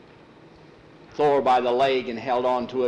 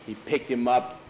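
plain reading voice

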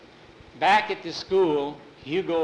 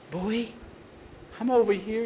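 scared call; 8 semitones higher than usual. Acted. Boys tied up in cemetery.

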